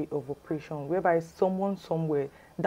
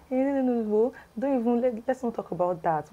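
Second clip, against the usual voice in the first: higher-pitched, quick interrupting protest; dismissive brush-off; 6 semitones higher than usual. Retold or imitated. Caller dodging questions about the office, acted brush-off. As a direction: wave the question away in a higher voice.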